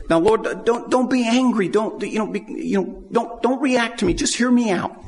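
Abraham pleading with the Lord; anxious pleading. Retold or imitated. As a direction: plead for God not to be angry. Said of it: "he's almost pleading with him".

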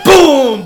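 loud, high explosion yell; shouted boom. Retold or imitated. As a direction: belt out the blast. Explosion narrated.

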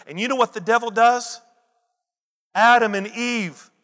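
usual speaking voice

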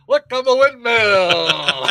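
high, slow hammed-up stage cry; windmill performer. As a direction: play a silly windmill announcing itself, drawn out. Plays a guy being a windmill.

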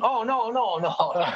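high laughing oh no no no; hammed-up protest through a laugh; mock dismay. Prisoners dismayed by the blessing.

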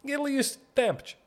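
high, quick squeaky take on the line; squeaky mock. Repeating father's ocean ritual as a squeaky joke. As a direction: squeal it like a cold shock.

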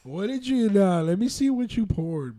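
high, loud disbelief; incredulous. Real, not acted. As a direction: sound shocked at the pour. Checking friend's pour.

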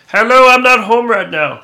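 high, loud mock voicemail greeting; silly answering machine voice. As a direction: put on a goofy voicemail voice. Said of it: "he's like".

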